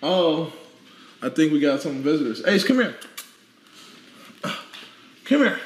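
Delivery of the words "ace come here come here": high, coaxing call to a dog; pet-calling voice. Real, not acted. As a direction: call him over in a high sing-song.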